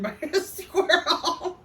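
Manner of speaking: ordinary talk, nothing marked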